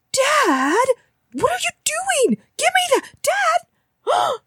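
put-on shrill high teen panic; panicked teen girl yelling at Dad; teenage girl freaking out.

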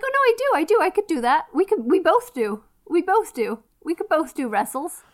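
high, hurried mock eagerness; eager protesting. Wrestler insisting they can both wrestle, eager mock protest. Voicing a wrestler scrambling to volunteer.

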